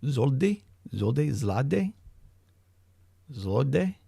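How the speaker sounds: "Zoldy", "Zlade", "Zlode" guessed with a rising tune; mangling the word, unsure; mangled pronunciation. Real, not acted. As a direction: fumble through "Zoldy" and "Zlode" like questions. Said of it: "I'm mangling that".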